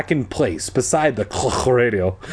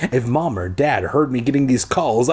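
plain reading voice

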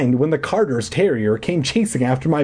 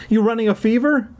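very high, concerned question; taken aback and checking on a child; startled mother; 10 semitones higher than usual. A narrator's reading. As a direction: ask in a pitched-up mom voice. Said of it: "a startled expression on her face".

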